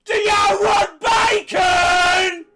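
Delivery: very high, slow wailing shout; bizarre shriek. Acted. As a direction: wail it out, drawn slow. Large woman's bizarre reply.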